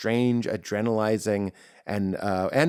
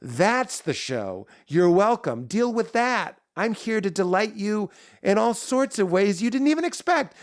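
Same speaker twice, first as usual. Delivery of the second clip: pure confidence; 7 semitones higher than usual. Acted. Comic's confidence answering a bombed joke, confident. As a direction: declare it grandly, pitch climbing as you go.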